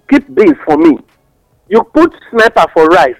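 usual speaking voice

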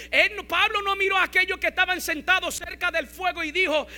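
loud, high, driving sermon delivery; full-force preaching. Acted. Pastor urging his congregation about Paul, preaching at full force.

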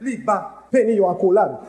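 ordinary talk, nothing marked

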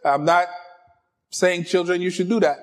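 ordinary talk

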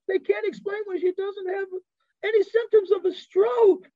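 a woman crying with joy over a miracle on the phone; overjoyed caller. Retold or imitated. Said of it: "She calls me up".

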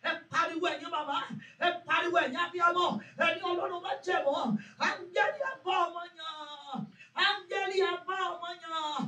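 loud, rising prayer cries; fervent prayer. Acted. Pastor leading mothers in prayer, fervent loud praying. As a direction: pray it out with growing fire.

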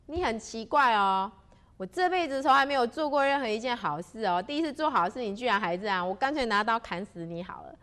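loud, angry bandit ranting; exasperated bandit outburst. Acted. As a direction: scold him like an annoyed robber. 强盗恼怒回骂邱大仙. (强盗气呼呼地大声回骂)

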